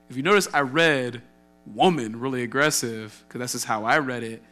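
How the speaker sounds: usual speaking voice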